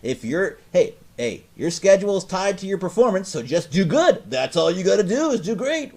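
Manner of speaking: high, loud mock pep talk; chirpy company loyalist. Employee parrots the company line to a coworker, mock-peppy. Mimics people defending company policy.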